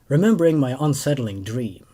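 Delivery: usual speaking voice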